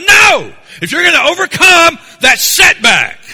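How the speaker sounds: a full, high-pitched shouted "No!" carrying into the next line; preacher's shout. Acted. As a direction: shout down the passive attitude. Shouted climax of sermon.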